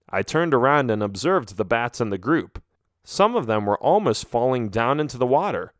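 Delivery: plain reading voice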